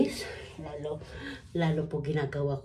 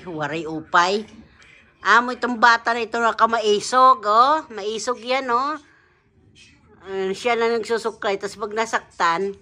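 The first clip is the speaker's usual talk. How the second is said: loud excited talk; 6 semitones higher, 11 dB louder than usual. Real, not acted. Loud, excited talk beside sleeping child.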